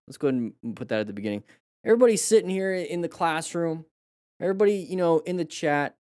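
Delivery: normal talking voice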